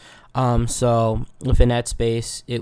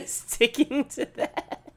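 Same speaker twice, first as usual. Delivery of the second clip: high, slow, squeaky laughing words; breaking into laughter; 13 semitones higher, much slower than usual. Real, not acted. Admitting to viewers the lost routine, breaking into laughter. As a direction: crack up while finishing the sentence.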